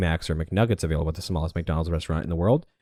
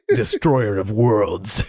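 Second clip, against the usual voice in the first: slow epic announcer voice; much slower than usual. Pretend. Epic title for drag queen.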